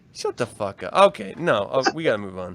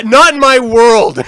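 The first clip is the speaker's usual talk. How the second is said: emphatic; 2 semitones higher, 9 dB louder, slower than usual. Real, not acted. Emphatic rejection.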